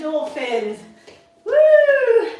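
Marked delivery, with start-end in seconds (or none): 1.4-2.3 high, loud cheering whoop; excited whoop. Real, not acted. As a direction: whoop it up to pump the class. Coach cheering mid-workout.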